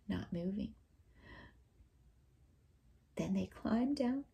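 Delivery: soft whisper; gentle storyteller whisper. A narrator's reading. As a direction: hush it down. Story winding down.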